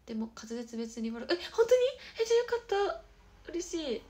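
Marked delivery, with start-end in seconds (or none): 1.3-4.0 high, loud burst of relief; squeaky-high and loud, overjoyed; delighted surprise. Real, not acted. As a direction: react with real thrilled disbelief. Delighted by a comment on diction.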